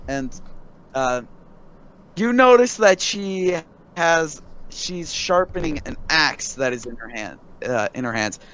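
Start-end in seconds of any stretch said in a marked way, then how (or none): none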